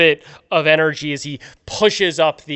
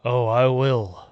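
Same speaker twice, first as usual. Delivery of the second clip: low, soft, a hint of menace; lightly voiced sinister creature; 6 semitones lower, 6 dB quieter, slower than usual. Loch Ness creature to the player, softly sinister. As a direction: purr the promise darkly.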